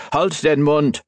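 high, firm command in German. A narrator's reading. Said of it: "He commanded".

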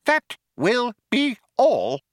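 slow, high, firm mouse voice. A narrator's reading. Benji dismisses the old man, firm mouse voice. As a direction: dismiss him word by word, pitched high. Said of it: "said Benji firmly".

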